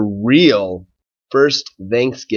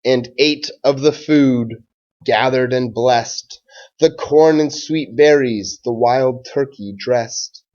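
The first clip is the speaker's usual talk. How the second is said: put-on slow, even rhyming read-aloud for children; story read-aloud. A narrator's reading. Teacher reading a Thanksgiving rhyme to his class, gentle storytime read-aloud. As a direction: read it like a picture book at storytime.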